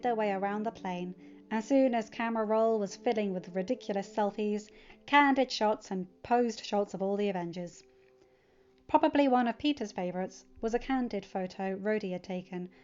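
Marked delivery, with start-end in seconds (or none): none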